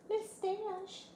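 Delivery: high cooing pet name; pet talk. Real, not acted. Owner greeting her cat, high cooing voice.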